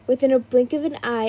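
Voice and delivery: plain reading voice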